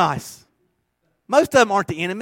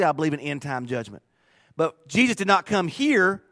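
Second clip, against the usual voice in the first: ordinary talk, nothing marked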